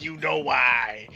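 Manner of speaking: ordinary talk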